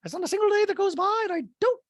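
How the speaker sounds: very high, loud mock-indignant voice; hammed-up squeaky impersonation; Dorit. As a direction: caricature her, piling on the emphasis. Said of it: "And Dorit's like".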